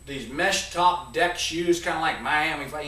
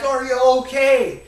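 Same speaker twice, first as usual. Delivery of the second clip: imagined concern; 7 semitones higher, 8 dB louder than usual. Pretend. Imagined dad checking on him.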